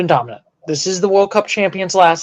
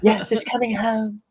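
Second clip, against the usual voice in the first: soft, raised, shaky-grade British impression; attempted British accent; 4 semitones higher, 8 dB quieter than usual. Answering a Trump-impression jibe, attempted British accent. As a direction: put on a poor British accent, softly. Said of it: "Trying to do my British accent".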